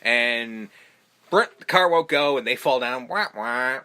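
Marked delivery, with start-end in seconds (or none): 1.3-1.5 mouth-made engine sputter. Retold or imitated. Car failing to start. 3.1-3.8 sung sad trombone wah wah; mock the failure like a trombone. Mocking failure.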